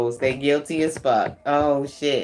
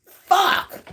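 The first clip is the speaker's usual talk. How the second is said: high loud exasperated curses; frustrated cursing; 12 semitones higher, 3 dB louder than usual. Retold or imitated. Frustrated ex cursing a failed plan, high loud curses. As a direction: spit it out like a thwarted schemer. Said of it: "They like".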